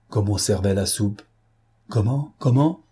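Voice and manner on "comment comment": startled question, climbing on the repeat; understated outcry of disbelief; crying out. A narrator's reading. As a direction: exclaim it in mild alarm, second one higher. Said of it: "cria madame Zaptine".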